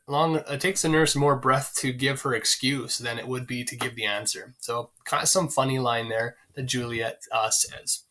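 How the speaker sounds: usual speaking voice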